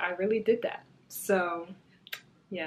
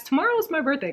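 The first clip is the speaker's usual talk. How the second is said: usual speaking voice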